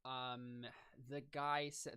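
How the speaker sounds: ordinary talk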